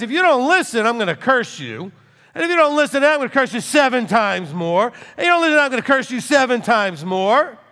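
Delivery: high, loud, escalating threat; forceful warning. Acted. God warning those who don't listen, loud and high. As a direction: hammer out each warning at full voice. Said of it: "No, he says".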